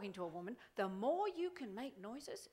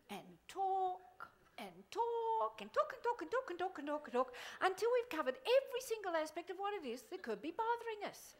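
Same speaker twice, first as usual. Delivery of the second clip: exaggerated endless talking; 7 semitones higher than usual. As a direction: stretch every talk like it never stops. Mocking women talking on and on.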